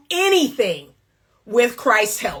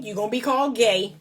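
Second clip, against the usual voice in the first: usual speaking voice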